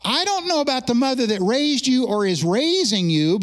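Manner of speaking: normal talking voice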